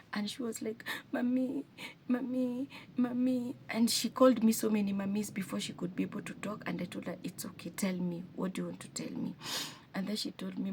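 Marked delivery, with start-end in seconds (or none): 1.1-3.6 lightly voiced little girl, higher; a hint of a tearful child calling Mommy; mild imitation of a crying girl; small daughter calling. Daughter sobbing calls mommy repeatedly. 7.2-9.4 softer, hushed reassurance; quietly soothing a sobbing daughter; gentle coaxing. Retold or imitated. Mother gently coaxing crying daughter.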